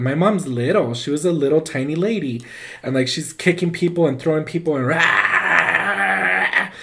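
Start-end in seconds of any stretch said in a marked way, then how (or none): 4.9-6.7 long, drawn-out mock roar; imitated feral yell; possessed roar. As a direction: roar like someone thrashing possessed. Imitates possessed mother lashing out.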